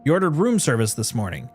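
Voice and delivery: emphatic indignant employee venting; dramatic note reading. Acted. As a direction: read the complaint out as an outraged staffer. Reads a game note in character.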